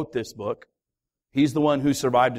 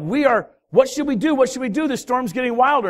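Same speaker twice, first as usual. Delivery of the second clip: higher, urgent voice of frightened sailors; mock panic, pitched up and pressing; panicked sailors; 7 semitones higher than usual. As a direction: sound like men scared of the storm.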